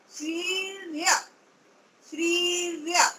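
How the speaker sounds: श्रीर्य said slowly, with the र clearly sounded after श्री; teacher modelling श्रीर्य slowly; slow demo pronunciation. Shown as a demonstration. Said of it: "अब र का उच्चारण आना चाहिए".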